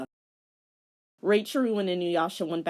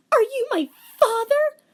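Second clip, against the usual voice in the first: put-on high girlish voice, much louder; girl demanding; 9 dB louder than usual. Angry girl demands identity.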